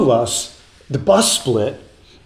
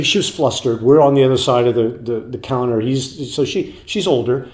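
normal talking voice

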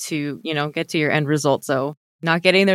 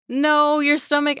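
high emphatic no; playful high protest; 10 semitones higher than usual. Real, not acted. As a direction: cut in with a high no. Protesting friend's joke.

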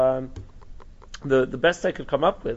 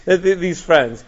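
much higher and louder, slowed protest; fervent quote; 7 semitones higher, 9 dB louder, slower than usual. Retold or imitated. Teacher voicing the rabbi defending his friends, high and loud. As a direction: insist they were good guys, voice soaring. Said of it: "So he says".

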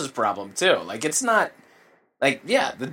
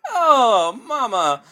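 jolly Italian pizza chef; 4 semitones higher than usual. Pretend. Continues the Italian bit.

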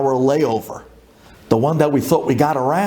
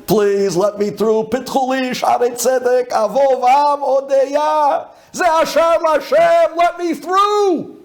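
building desperate plea. Retold or imitated. Traveler stuck in line praying to get through, increasingly desperate.

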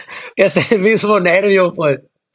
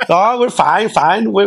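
put-on English with a Mexican accent; 2 semitones higher, much slower than usual. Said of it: "le decíamos".

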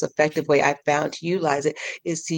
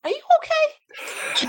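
much higher, slow, laughing through the question; laughing mock concern; slower than usual. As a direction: giggle as you ask your mind if it's okay. Laughing at her own thoughts.

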